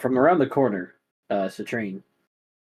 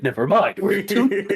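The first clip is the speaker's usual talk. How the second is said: mock eager; 8 semitones higher, 4 dB louder than usual. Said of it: "He just, all my face, like".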